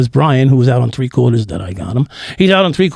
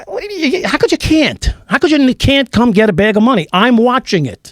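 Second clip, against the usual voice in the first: high, incredulous retelling of his own outburst; pitched up in disbelief, mock yelling at a friend; exasperated yell; 9 semitones higher than usual. To a friend who won't come for the money, incredulous high yell. Retold phone call, friend refuses.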